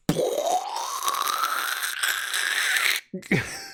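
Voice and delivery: long unvoiced sputtering mouth noise; drawn-out imitated splurting sound; bean-pouring noise. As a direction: imitate beans gushing out.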